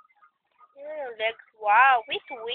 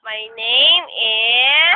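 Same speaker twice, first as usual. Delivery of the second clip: loud, slow and stretched lead-in; prompting; 3 semitones lower, 7 dB louder, much slower than usual. Shown as a demonstration. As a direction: draw out "is" and leave it hanging. Prompting child to finish sentence.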